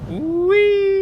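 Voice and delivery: high squeal of glee; gleeful whee. Acted. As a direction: squeal like on a slide. Jumping off gleefully.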